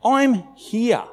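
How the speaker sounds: high, mild reassurance; calm them down; a hint of soothing Jesus; reassuring Jesus. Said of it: "Jesus says".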